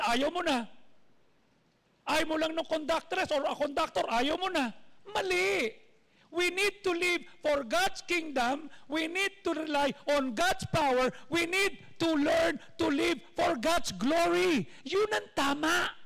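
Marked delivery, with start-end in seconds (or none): 6.3-15.9 slow, emphatic, rising preacher's call; slow, loud pulpit proclamation; forceful preaching. Acted. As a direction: drive the truth home to the congregation. Preacher pressing the point.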